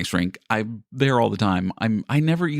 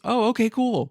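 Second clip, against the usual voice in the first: much higher, easygoing agreement; imitating a teammate; 12 semitones higher than usual. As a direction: lightly voice the teammate's casual okay. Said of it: "And he goes".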